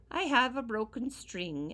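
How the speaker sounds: ordinary delivery, nothing marked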